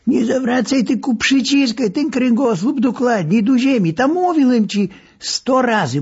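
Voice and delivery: doctor scolding patient during exercises; nagging instructor. A narrator's reading. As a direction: drill the patient, fed up.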